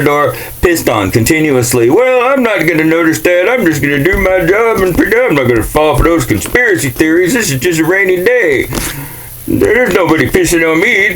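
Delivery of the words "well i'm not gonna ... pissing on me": much higher, smug mock voice; high smug mock voice of a man ignoring the rain; oblivious average American. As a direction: play the clueless citizen in denial.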